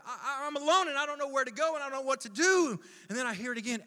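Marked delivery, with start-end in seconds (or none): 0.0-2.8 fast, high, louder stammering; panicked. Acted. Lost man alone with nowhere to go, panicked. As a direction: spill it out in rising panic.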